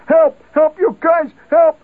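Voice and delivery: high, loud frantic yells; screaming for help. Retold or imitated. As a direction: panic like a man up to his knees. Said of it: "he's screaming".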